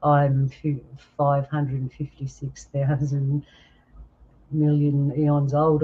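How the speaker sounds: slow, drawn-out mock-clever answer; a know-it-all dragging out an absurd age; smug smart answer. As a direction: stretch the huge number out slowly. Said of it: "smart people say something like this".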